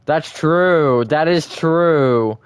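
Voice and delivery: loud, high, very slow "That is true"; emphatic agreement. Real, not acted. Streamer loudly agrees with chat, slow and emphatic. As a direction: drag every word out for emphasis.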